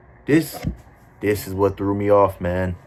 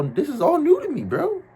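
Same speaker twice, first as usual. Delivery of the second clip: incredulous squeak; 4 dB louder than usual. Retold or imitated. Shocked at party drugs.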